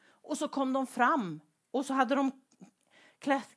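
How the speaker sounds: ordinary talk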